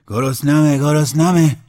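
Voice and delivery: high, loud bellow of hunger; a desperate hungry roar. Acted. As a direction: roar it out like a starving beast. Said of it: "نعره کشید".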